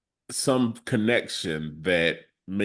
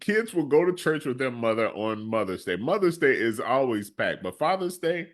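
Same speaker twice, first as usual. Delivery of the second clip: animated complaint; 8 semitones higher than usual. Real, not acted. Animated complaint about Father's Day.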